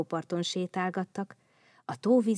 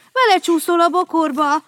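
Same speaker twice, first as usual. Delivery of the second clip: high, much louder warning cry; alarmed shout; 9 semitones higher, 12 dB louder than usual. Acted. Babóca warning Vendel about the bush, alarmed shout. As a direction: scream the danger ahead. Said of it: "kiáltotta Babóca".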